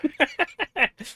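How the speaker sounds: high squeaky gremlin giggle; squeaky gleeful gremlin giggle. Acted. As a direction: cackle like a gleeful little gremlin. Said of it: "your inner gremlin".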